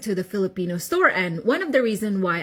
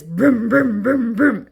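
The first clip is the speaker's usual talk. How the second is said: punchy vocal engine revs; imitated engine roar; 3 dB louder, slower than usual. Storyteller imitates the Lamborghini heard on the street, loud engine noise. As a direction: mimic a loud car revving in short bursts. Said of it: "It's very loud".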